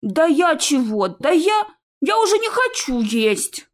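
slow, high-pitched, flustered whine; panicky protest. Acted. Naev told to go eat as doors crash, flustered. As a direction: back down, pitch climbing.

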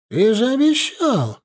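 high, slow reproach; acted aggrieved protest; protesting objection. A narrator's reading. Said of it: "возразила ему старшая соседка".

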